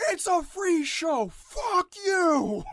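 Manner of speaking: loud, high, drawn-out yell; mock outburst at the audience; yelling at listeners. Host telling off complaining listeners, yelling. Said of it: "don't yell at 'em".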